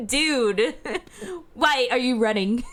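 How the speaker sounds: surfer dude through laughter; laughing dude voice. Acted. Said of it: "surfer dude voice".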